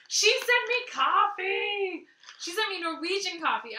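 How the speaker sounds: loud, high and slow with delight; thrilled. Real, not acted. Viewer hears about the Norwegian coffee gift, thrilled shout. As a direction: she sent me coffee shouted out in joy.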